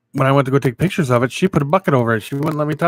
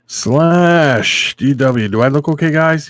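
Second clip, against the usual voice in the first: calling out; 4 semitones higher, 6 dB louder, slower than usual. Real, not acted. Calling out to chat loudly.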